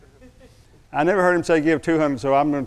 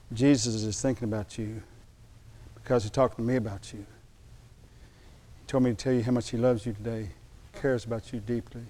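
tender; 3 semitones lower, 7 dB quieter than usual. Retold or imitated. Tender message to the young man.

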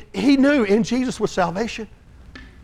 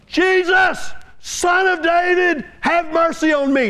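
pleading cry for mercy; 8 semitones higher than usual. Retold or imitated. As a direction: call out to him across the road. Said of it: "as he begins to cry out".

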